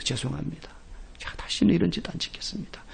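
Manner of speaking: hushed, remorseful apology; mock penitent apology. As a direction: whisper it like a guilty penitent. Penitent apology, head bowed.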